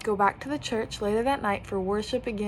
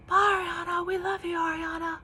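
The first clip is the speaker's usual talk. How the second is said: high sing-song chant from a bus window; mock chorus of teasing boys; boys shouting; 9 semitones higher than usual.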